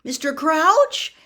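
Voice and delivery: put-on boy shouting to a collapsed man; loud. A narrator's reading. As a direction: call out to rouse him. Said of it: "Harry said loudly".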